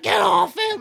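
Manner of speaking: high, loud laughing shout; frantic yell. Retold or imitated. Brother charging at the neighbour, high laughing yell. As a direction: scream it as you run in. Brother defending dad in fight.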